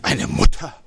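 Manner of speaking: a touch of mock outrage; angry cry. Brother scoffs angrily at his sister's idea, mild mock anger. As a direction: toss the words back in disbelief. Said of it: "rief er zornig".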